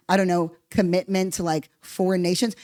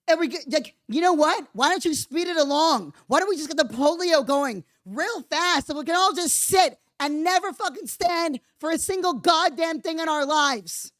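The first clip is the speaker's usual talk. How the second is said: higher, mocking tirade; higher-pitched scornful mockery; sarcastic rant; 7 semitones higher than usual. Real, not acted. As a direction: pile on the sarcasm.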